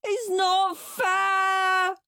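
high, long-drawn whiny wail. A narrator's reading. Henry complaining to mum about chores, wailed. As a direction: wail it slowly like a sulking child. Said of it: "wailed Henry".

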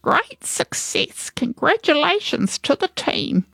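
very high-pitched granny praise; congratulatory granny. Acted. Granny congratulates her gang.

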